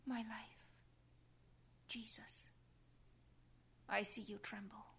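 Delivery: soft devoted whisper; hushed prayer. Real, not acted. The devotee speaking to trembling Jesus, whispered. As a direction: breathe it to him tenderly.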